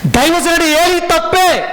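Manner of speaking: high, loud, slow proclamation; emphatic preaching cry. Real, not acted. Preacher to congregation, declaring the priest at fault, loud and high. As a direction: ring out the verdict like a preacher.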